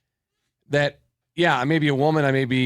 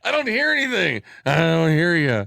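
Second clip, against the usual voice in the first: put-on confused old man voice; 4 semitones higher than usual. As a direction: whine like you can't hear. Mocking confused headset wearer.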